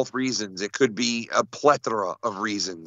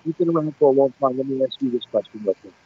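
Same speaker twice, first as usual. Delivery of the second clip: muffled mic. Real, not acted. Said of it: "You're covering your mic".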